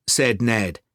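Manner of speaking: usual speaking voice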